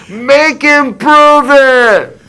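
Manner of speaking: high, loud, drawn-out yell; rowdy demanding shout, stretched out; screamed challenge. Retold or imitated. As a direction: heckle from across the hall. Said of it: "someone else screams out".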